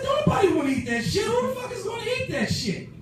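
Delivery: very high, screechy comic outburst; fast, high-pitched incredulous shouting; outraged yell. Retold or imitated. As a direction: act out exploding at a stupid friend. Retold outburst over botched sandwich.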